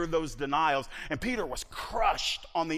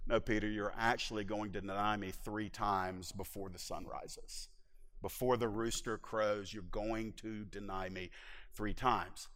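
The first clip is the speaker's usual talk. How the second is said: gentle correction; 11 semitones lower, 11 dB quieter than usual. Retold or imitated. Jesus quietly tells boasting Peter he will deny him, low and soft. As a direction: let him down softly, voice dropped low.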